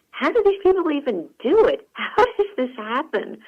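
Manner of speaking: high, loud incredulous questioning; baffled disbelief. Retold or imitated. Shopper thinking about people looting stores, in loud disbelief. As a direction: voice leaping up in bewildered exasperation. Acts out own baffled thought.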